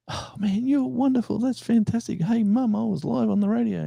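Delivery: very high squealing thrilled fan; excited listener calling out to Mum. Retold or imitated. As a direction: squeal like a kid who heard themself on air.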